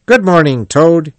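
high, loud cheery greeting; cheerful. A narrator's reading. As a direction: greet a friend brightly, voice lifted. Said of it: "he said cheerfully".

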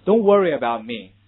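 loud American "Don't worry about me", r-coloured schwa in worry; American English. Shown as a demonstration. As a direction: model American "worry" loudly for students. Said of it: "这是美式读法". (美式发音大声读worry)

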